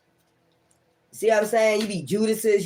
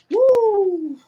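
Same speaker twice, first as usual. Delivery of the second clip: a loud, much higher "woo" of joy; excited whoop; 5 dB louder than usual. Real, not acted. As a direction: let out a happy whoop. Celebrating the reading's result.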